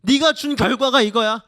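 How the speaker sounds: high, loud accusing outcry; angry resentful complaint. Acted. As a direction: confront the leader as a betrayed crowd. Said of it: "사람들이 이렇게 원망하는 거예요".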